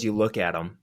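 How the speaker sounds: usual speaking voice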